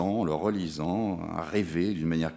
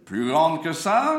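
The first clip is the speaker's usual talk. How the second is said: high, slow ringmaster coaxing; 8 semitones higher, 6 dB louder, slower than usual. Acted. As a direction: draw it out, asking wider. Coaxing the clown to open wider.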